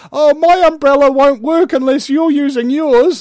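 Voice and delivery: high, loud mock-worried quote; mock complaint. Man in a joke fretting about an umbrella, mock complaint. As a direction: put on a high voice for the joke's complainer. Retells a man's mocking joke.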